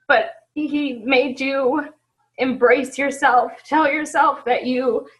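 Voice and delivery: real tears welling up; holding back tears. Real, not acted. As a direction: fight the tears as you push the words out. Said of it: "I'm gonna cry".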